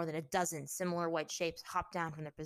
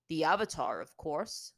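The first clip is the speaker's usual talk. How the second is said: offhand; 7 dB louder than usual. A narrator's reading. Captor answering his prisoner, offhand. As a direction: toss it off as obvious. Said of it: "Bato answered offhandedly".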